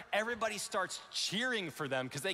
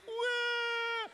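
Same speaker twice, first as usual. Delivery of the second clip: mock wail. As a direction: bawl like a crying toddler. His kids crying in corner.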